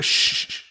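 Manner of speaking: sharp repeated "shh"; hushing. Acted. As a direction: shush someone who is too noisy. Imagined hunter hushing a talker.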